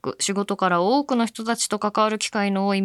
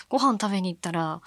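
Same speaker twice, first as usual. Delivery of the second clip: normal talking voice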